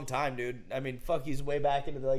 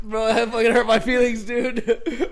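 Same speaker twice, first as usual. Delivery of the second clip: laughing mock hurt; 6 semitones higher, 5 dB louder, slower than usual. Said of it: "And I was like".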